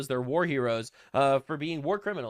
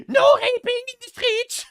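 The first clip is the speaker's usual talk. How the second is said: high, shouted mock-German accent; hammed-up German shriek; 8 dB louder than usual. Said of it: "In Germany, it's more like".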